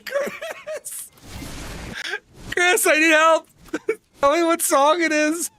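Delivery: high, laughing call for help; laughing plea. Real, not acted. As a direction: plead through giggles. Laughing plea as app crashes.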